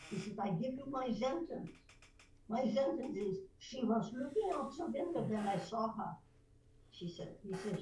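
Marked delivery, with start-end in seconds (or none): 0.4-6.1 put-on German accent. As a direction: coach reciting with a German accent. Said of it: "and I could do German".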